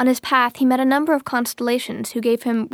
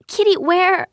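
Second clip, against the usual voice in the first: put-on high, questioning child's voice; high little-girl call, cut short; child calling her cat; 7 semitones higher, slower than usual. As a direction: call out after a runaway pet, high-pitched. Child calls after her cat.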